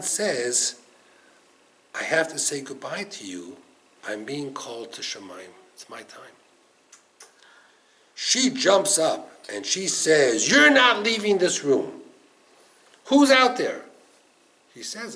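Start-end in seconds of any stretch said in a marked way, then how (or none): 1.9-6.3 soft, low, resigned goodbye; subdued farewell. Retold or imitated. Groom telling his bride he is called away, subdued. 10.5-13.8 high, forceful command, then demand; fierce insistence. Retold or imitated. Bride stopping her husband from leaving, fiercely protective. Bride forbids groom to leave.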